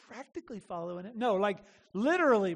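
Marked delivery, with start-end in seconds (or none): 0.1-1.2 "Practically" tossed off fast and soft, meaning almost; throwaway practically. Shown as a demonstration. Preacher mocking "Practically" as almost, fast and soft. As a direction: rush "Practically" quietly like a dismissive almost. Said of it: "Not practically meaning, like, almost".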